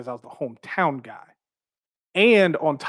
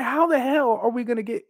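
much higher, baffled question; high-pitched disbelief; 9 semitones higher than usual. Retold or imitated. Wondering how to turn heel right after a babyface win, baffled. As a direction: squeak it out, totally baffled. Bewildered thought about turning heel.

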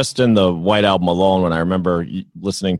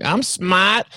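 Fredo; 9 semitones higher than usual. Retold or imitated. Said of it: "It was Fredo saying".

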